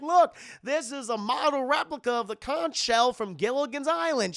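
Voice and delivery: high, loud show-and-tell; excited Goofy. Retold or imitated. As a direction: proudly show off a treasure. Voices Goofy showing his shell.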